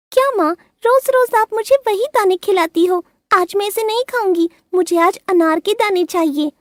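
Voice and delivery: put-on high childish whine; whiny little bird. A narrator's reading. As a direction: complain like a fussy little girl. Rinki bored, demanding.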